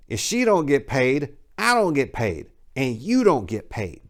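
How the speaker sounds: half-acted pimp laying down the rules; menacing pimp. Pimp to the hotel clerk, lightly voiced menacing pimp.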